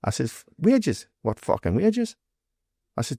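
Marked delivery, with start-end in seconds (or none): 0.6-2.2 high-pitched, indignant questioning; incredulous retort. Retold or imitated. Doorman retelling his reply to a wage demand, incredulous. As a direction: throw the word back in disbelief. Retold argument over wages demand.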